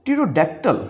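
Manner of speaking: pterodactyl said with a silent P, starting at the T. Shown as a demonstration. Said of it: "and we start from here".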